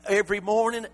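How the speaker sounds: ordinary talk, nothing marked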